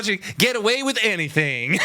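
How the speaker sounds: a light, laughing hint of Southern drawl; mock Southern drawl, high and amused; playful imitation of a Southern drawl; bad Southern drawl. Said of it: "That's a really bad, uh, uh, attempt at".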